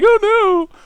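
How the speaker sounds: hammed-up whiny wail; mock whiny self. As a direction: wail like a hurt little kid. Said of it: "I'd be like".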